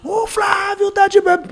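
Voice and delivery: high, drawn-out mock shout; imitated beach shout. Beach crowd shouting the news about Flávio, high drawn-out imitated shout. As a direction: call out across the sand like a beach vendor.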